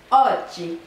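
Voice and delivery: oggi with a loud opening O, dropping on "dji"; stress on first syllable. Shown as a demonstration. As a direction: punch the O in oggi. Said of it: "sur la première syllabe".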